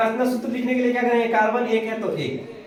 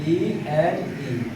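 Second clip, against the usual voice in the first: ईएनई stretched very slowly, letter by letter; teacher drawing out ईएनई so students catch it; drawn-out suffix; 6 semitones lower, 5 dB quieter, much slower than usual. Shown as a demonstration. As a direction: spell out ईएनई slowly for the class. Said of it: "suffix हमारा क्या है?".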